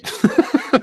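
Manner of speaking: amused real laugh, high-pitched. Real, not acted. Speaker owning up to hasty texting, real laugh. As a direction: laugh at your own admission.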